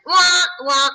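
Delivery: mock cornet cry.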